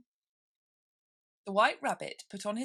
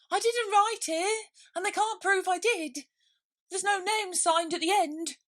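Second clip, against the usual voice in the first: pleading knave; 13 semitones higher, 7 dB louder than usual. Acted.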